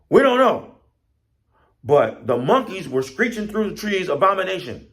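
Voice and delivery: high, loud agitated answer; flustered protest. Acted. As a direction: protest excitedly as the panicked birds. Flustered birds answer in alarm.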